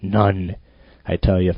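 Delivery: ordinary talk, nothing marked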